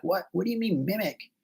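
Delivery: lightly put-on bewildered voice; puzzled learner. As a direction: imitate a baffled student asking. Retold puzzled learner's question.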